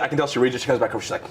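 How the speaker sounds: ordinary delivery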